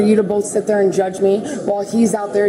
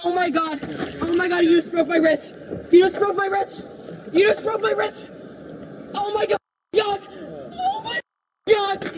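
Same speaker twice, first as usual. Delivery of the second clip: higher, anguished cries; shocked outrage; 9 semitones higher than usual. Real, not acted. Woman shouting at officers after wrist pain, anguished shock.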